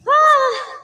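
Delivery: high loud straining scream; strained scream. Real, not acted. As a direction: scream through the rep. Lifting at failure.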